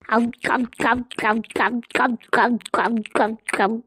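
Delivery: low, repeated voiced glugs; exaggerated glug-glug of downing liquor; mimed gulping noises. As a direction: fake drinking with short throaty gulps. Drinks the liquor, imitated gulps.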